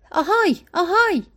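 put-on old man calling a name across a gas station. A narrator's reading. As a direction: call out to get his attention. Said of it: "آقای اوتیس صداش زد".

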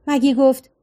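usual speaking voice

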